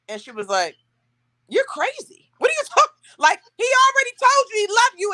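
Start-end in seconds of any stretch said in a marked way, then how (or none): 1.5-5.1 high-pitched, incredulous imitation; exasperated sister, pitched way up; scolding sister. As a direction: tell her off in a much higher voice. Retold sister scolding her on the call.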